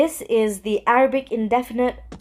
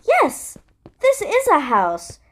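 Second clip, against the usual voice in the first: higher answer; 8 semitones higher, slower than usual. Acted.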